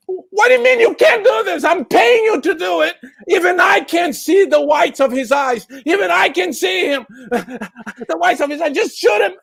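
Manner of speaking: furious shout. Acted. Driver yells at the guard he is paying. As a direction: tear into him for not shooting.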